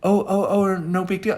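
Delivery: high, airy string of ohs; imitating a friend making light of it; breezy brush-off.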